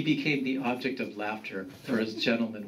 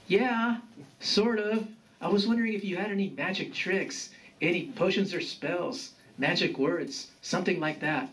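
lightly voiced young grandson, starting higher; hesitant grandson; 7 semitones higher than usual. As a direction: ask grandfather tentatively, easing down in pitch.

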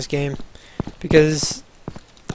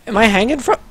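ordinary talk

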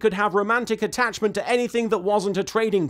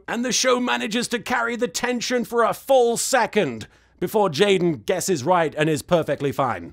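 ordinary talk, nothing marked

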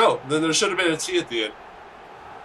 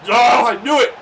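loud, high exasperated cry; frustrated outburst; 10 semitones higher, 11 dB louder, slower than usual. Real, not acted. Gamer who saw it coming, loud frustrated yell. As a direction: yell it as the play goes wrong.

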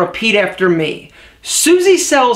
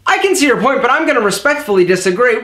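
too fast; faster than usual. Shown as a demonstration. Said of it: "let's do a little experiment".

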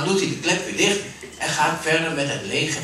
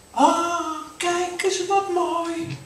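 put-on much higher child's voice, slowly marvelling; a small child amazed by a find; delighted child; 3 dB louder than usual. Kind vindt de engel. (verrukt kinderstemmetje)

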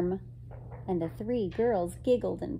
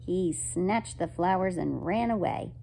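ordinary delivery, nothing marked

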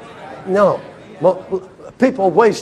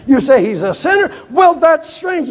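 mock indignant shout; 8 semitones higher, 3 dB louder than usual. Blind man challenges the rabbis.

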